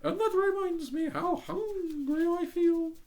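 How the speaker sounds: prim little duck voice, high. Acted.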